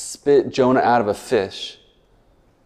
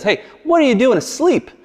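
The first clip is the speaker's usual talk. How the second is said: high, urgent call to wake a sleeper; captain rousing a sleeping passenger, high and urgent; alarmed ship captain; 10 semitones higher, 4 dB louder than usual. Acted. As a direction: shake Jonah awake, high and pressing. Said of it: "the captain approaches Jonah and says".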